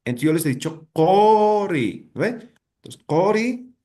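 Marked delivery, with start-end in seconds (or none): 0.9-2.1 "cori" drawn out, with a long O; vowel lowered to O. Shown as a demonstration. Teacher models the dictated word, drawing out the lowered O vowel. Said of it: "se va a pronunciar como si fuera la vocal O". 3.1-3.8 "cori" with O, pitched high at the end; vowel lowered to O. Shown as a demonstration. Teacher repeats the dictated word, vowel lowered to O. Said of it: "como si fuera la vocal O".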